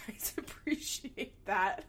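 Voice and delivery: words breaking up into laughter; giggling through the end of the sentence; laughing. Real, not acted. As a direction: crack up at your own rant. Laughing at her own rant.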